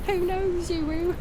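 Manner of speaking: attempted British mum with babyish w-for-r sounds; British uwu baby-talk mum. Streamer doing requested redeems, British uwu baby-talk voice. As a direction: mix a British accent with cutesy baby talk. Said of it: "while I'm doing uwus and mum voices".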